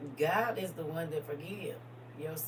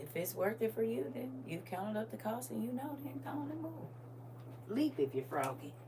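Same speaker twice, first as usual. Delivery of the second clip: lightly higher, soft, a hint of a voice from beyond; God's voice; 6 semitones higher, 6 dB quieter than usual. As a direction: float the words up gently. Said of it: "He said".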